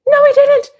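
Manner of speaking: very high, squeaky no he didn't; shrieking denial. Real, not acted. Vlogger denying Carter voted to viewers, in a very high shriek. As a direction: shriek it in disbelief.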